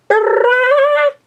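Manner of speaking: loud, high imitated horn blare; blowhorn blast. A narrator's reading. Pinkie waking Applejack with a loud horn blast. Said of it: "retrieved a blowhorn".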